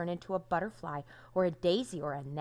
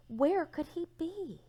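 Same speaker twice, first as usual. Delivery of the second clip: worried question; 9 semitones higher than usual. A narrator's reading. Worried parents wonder aloud.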